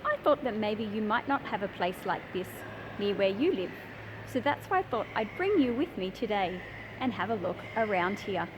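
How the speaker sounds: ordinary talk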